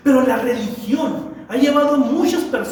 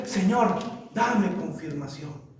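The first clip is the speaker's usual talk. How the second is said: mock pleading prayer; 5 semitones lower, 8 dB quieter, slower than usual. Mock believer praying for confirmation.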